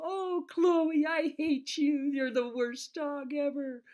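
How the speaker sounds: high, sweet pet-praising voice; sweet doggy talk. Retold or imitated. Owner teasing her Labrador, cooing mean words sweetly. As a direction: coo it like you're praising a good dog. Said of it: "they listen to tone of voice".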